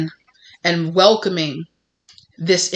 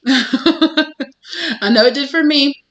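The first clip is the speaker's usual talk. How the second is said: laughing; 7 semitones higher, much slower than usual. Real, not acted. Laughing repeat of own line.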